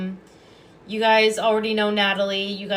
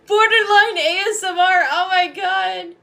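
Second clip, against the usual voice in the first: high and loud through real laughter; laughing; 10 semitones higher, 6 dB louder than usual. Real, not acted. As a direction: repeat it while cracking up. Repeating viewer comment, laughing.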